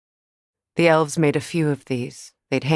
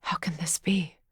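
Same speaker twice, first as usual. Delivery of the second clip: softer, breathless whisper of disbelief; hushed whisper at a ruined place; 7 dB quieter than usual. Acted. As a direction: barely voice it, stunned. Stunned at the crater.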